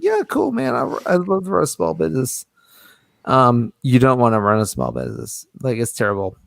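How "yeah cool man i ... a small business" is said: put-on naive young self. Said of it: "I was like".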